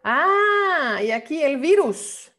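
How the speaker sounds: ordinary talk, nothing marked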